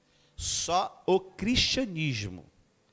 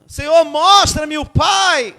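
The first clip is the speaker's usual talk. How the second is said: loud, slow, high pleading cry; crying plea; 9 semitones higher, 12 dB louder, slower than usual. Acted. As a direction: cry out to the Lord, begging. Philip's plea cried out loud.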